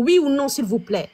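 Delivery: usual speaking voice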